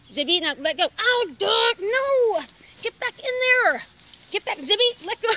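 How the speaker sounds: loud high yelling at the dog. Real, not acted.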